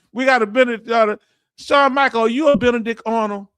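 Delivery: loud, fast words through real laughter; laughing. Real, not acted. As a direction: burst out laughing while reading the chat. Laughing at chat's joke.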